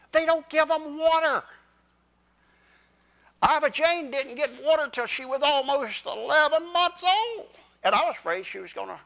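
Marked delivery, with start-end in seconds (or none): 0.1-7.6 high-pitched astonishment, climbing at the end; can't believe what you learned; mind-blown disbelief. Real, not acted. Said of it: "That blew my mind".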